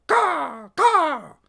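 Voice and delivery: high, loud raven squawk; sharp bird call; raven caw. Acted. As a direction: caw like a crow. Raven Anna interrupts.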